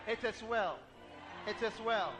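building declaration. Real, not acted. Repeated declaration building to a shout.

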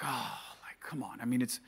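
near-whispered, dismissive; soft, airy wave-off of her concern; hushed brush-off. Retold or imitated. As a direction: breathe it out like it's nothing. Brushing off wife's worry.

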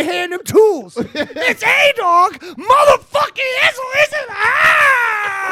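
hype announcer intro. Acted. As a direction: ring announcer in full hype. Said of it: "introduction like that".

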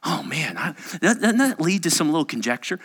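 ordinary talk, nothing marked

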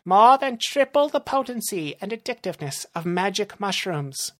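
high-pitched villain voice; boastful villain. Acted. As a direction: pitch it up, bragging of the product. Narrator voices Rumble.

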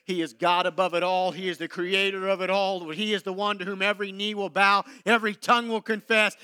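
ordinary talk

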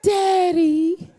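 coy Daddy with a rising lilt; sweet begging. Retold or imitated. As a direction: flutter it sweetly to get your way. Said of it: "they bat their eyes".

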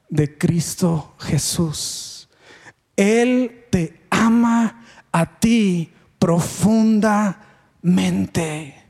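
very slow, weighted delivery with pauses; slow, solemn. Retold or imitated. Pastor telling a boy of Jesus, slow and solemn. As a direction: draw out each word deliberately.

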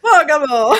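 loud laughing repeat of the name. Real, not acted. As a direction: laugh through the word Bergamo. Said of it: "with the Bergamo".

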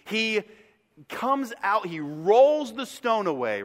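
usual speaking voice

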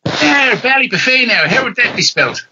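high-pitched Irish character voice; Irish accent. Acted. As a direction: play an Irish rally mechanic, pitched well up. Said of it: "I needed an Irish character".

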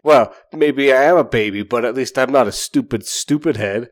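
mock playground insult; childish retort. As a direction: fire back like a sulky kid. Childish comeback to note.

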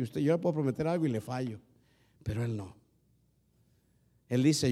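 usual speaking voice

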